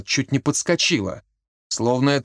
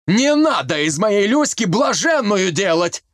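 yelling; 6 semitones higher than usual. A narrator's reading. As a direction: roar it at her. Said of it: "начал орать Колька".

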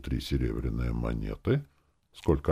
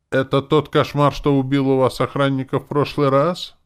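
high, loud horrified question; 10 semitones higher, 7 dB louder than usual. A narrator's reading. Woman realising who the demon is, horrified. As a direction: ask in alarm, voice raised. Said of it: "прошептала Лика в ужасе".

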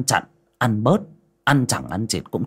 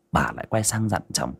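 plain reading voice

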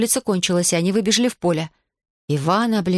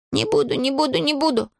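high, quick string of protests; mild cry; 8 semitones higher than usual. A narrator's reading. Иван promises before bolting out the window, mild high cry. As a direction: cry it out high without real volume. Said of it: "крикнул Иван".

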